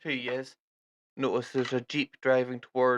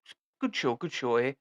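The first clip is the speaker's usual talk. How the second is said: lightly voiced jolly British officer; hearty British army invitation; a hint of a British captain. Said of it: "he's like".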